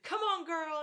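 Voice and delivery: loud, high cheer with a laugh; rallying shout. Retold or imitated. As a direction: yell it to spur her on. Retold birth coaching, suddenly yelled.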